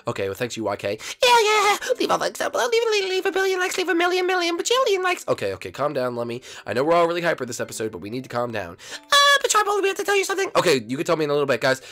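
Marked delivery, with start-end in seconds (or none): 1.2-5.3 very high, squeaky excited sidekick; 兴奋小跟班. Acted. 9.1-10.5 very high, squeaky sidekick, eager to tell; 急切小跟班. Acted.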